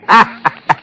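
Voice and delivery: high, sharp burst of laughter; gloating laugh. Acted. Witness pictures the electric chair for Kurt, high gloating laugh. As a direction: laugh with vengeful delight.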